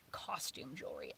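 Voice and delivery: a voiceless, scornful aside; whispered sneer. Real, not acted.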